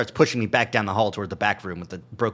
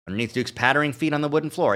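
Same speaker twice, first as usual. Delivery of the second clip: usual speaking voice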